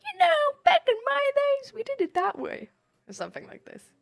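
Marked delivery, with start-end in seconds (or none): 0.0-2.7 put-on high, loud creaky old grandpa. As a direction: play a cranky old grandpa. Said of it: "like, a grandpa voice and then be like".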